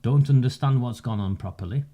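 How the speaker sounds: ordinary talk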